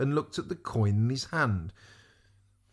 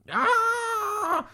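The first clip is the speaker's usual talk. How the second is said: high-pitched cry of alarm; long, high "aah" of dismay; shouted cry. A narrator's reading. Squire losing the beard to wind, high startled cry. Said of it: "the squire shouted".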